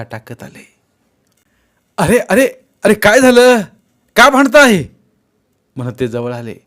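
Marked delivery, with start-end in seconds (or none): 2.0-4.8 high, very loud intervention; urgent high-pitched questioning shout; alarmed shouting. A narrator's reading. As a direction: yell to break up a fight. Man runs up to a fight.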